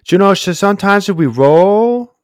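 lightly imitated Brazilian accent of a fighter; mock Brazilian accent. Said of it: "this Brazilian accent".

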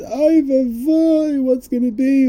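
high, hammed-up worried wail; a touch of townsfolk panic; mock fretting. Townspeople fretting over the rabbi, high mock worry. People fretting about the rebbe.

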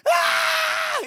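high loud ah as he falls; terrified yell. Retold or imitated. Storyteller half sunk in mud, high terrified yell. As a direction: scream as you sink to the waist. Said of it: "in the mud going".

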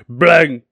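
a loud mock gunshot yell; shouted bang. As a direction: blurt BANG like a gun firing. Gunshot in acted orc skit.

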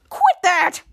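high, loud mock command; scolding. Henry yells at a squirrel to stop, scolding.

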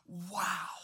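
a breathless whispered wow; whispered awe; awed whisper. Pretend. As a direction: whisper it, struck by wonder. Adam and Eve amazed.

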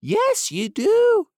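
high duck voice scolding the doctor; bossy duck. Acted. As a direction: insist like a fussy duck. Duck Dab Dab insists.

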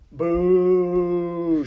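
very long, high, loud jeer; shouted boo. Acted. Rat jeers as the mouse lowers his needle, shouted. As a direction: hold the boo at full volume. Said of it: "shouted Botticelli again".

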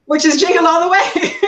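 cracking up at his own joke; laughing. Real, not acted.

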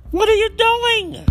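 high indignant squeal of anger; angry disbelief, voice shooting up high. Real, not acted. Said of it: "I am angry".

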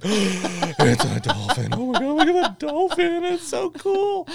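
high, loud, laughing gush over a dolphin; mock a tourist squealing at a dolphin; mocking a tourist seeing a dolphin for the first time; gasping tourist.

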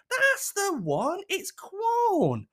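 very high, drawn-out mimicry; exaggerated high put-on voice; confirming missus. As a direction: imitate a partner brightly confirming, slow and squeaky.

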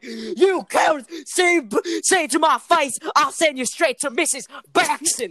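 loud, high country-twang yelling; Mister Cox. Retold or imitated. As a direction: imitate an irate old teacher threatening punishment. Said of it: "Mister Cox turns around and just went".

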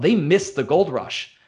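usual speaking voice

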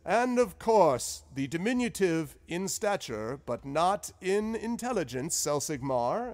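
bow with your voice; grand wizard compliment. Acted. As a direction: wizard flattering the small scholar. Zephyros greeting Selsigmar.

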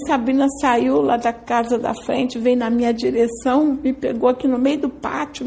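usual speaking voice

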